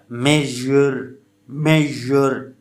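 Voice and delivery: मेजर slowly, with a drawn-out zh in the middle; fricative demo. Shown as a demonstration. Teacher showing students fricatives, मेजर slowly and distinctly. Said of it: "ये इस किस्म के sound फ्राइकेटिव".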